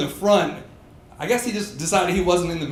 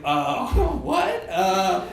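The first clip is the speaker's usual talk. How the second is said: flustered laughing; 3 semitones higher, slower than usual. Retold or imitated. Flustered stammering to boss.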